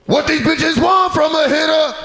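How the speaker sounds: high shouted [name] line. Retold or imitated. [name] ignoring the women at the bag, high shout. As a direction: bark it like [name]. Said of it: "He's like".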